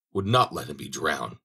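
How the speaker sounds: plain reading voice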